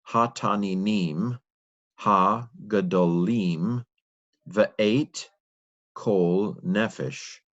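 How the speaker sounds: sounding out Hebrew word by word, slowly; slow careful reading. Shown as a demonstration. Learner reading Genesis aloud, slow and careful. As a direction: read each word deliberately, pausing between.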